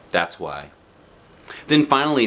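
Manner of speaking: ordinary delivery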